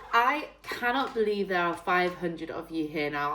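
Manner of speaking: ordinary delivery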